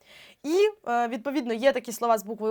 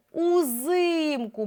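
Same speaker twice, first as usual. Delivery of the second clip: УЗИМКУ with a long stressed И, pitched up; наголос на И; 4 semitones higher, much slower than usual. Shown as a demonstration. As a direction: stretch out the И in УЗИМКУ. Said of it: "цей склад став наголошеним". (УЗИМКУ з протяжним наголошеним И)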